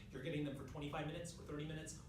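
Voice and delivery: ordinary delivery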